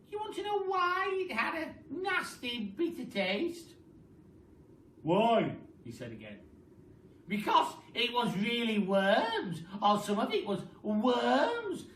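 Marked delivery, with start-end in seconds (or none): 0.1-3.7 high, loud witchy crow; cackling Mrs Twit. Acted. Wife gloating to her husband in a high witchy voice. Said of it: "cried Missus Twit". 5.0-5.6 loud blunt question; gruff Mr Twit. Acted. Grumpy husband demanding an answer, loud. 7.3-11.9 loud, slow gleeful reveal; gloating Mrs Twit. Acted. Wife gloating over her trick, loud and slow. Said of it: "cried Missus Twit".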